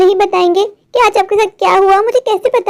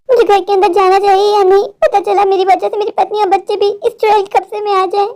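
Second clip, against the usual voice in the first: ordinary talk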